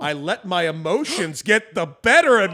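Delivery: slow, loud mock-dramatic build; hammed-up reading. Host performing an AI-written apology, hammed-up and loud.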